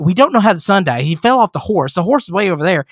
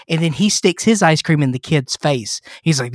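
ordinary talk

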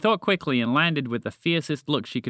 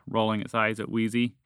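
ordinary delivery

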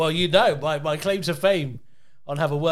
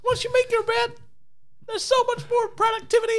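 very high, squeaky life advice; Jordan Peterson. Shown as a demonstration. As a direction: preach it in a Kermit parody voice. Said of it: "Can you do Jordan Peterson?".

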